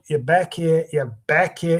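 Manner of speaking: Trump voice sunk back into the throat. Shown as a demonstration. Impressionist showing the real one, throat-placed Trump voice. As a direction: pull the sound back, throaty and gravelly. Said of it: "It gets in the throat".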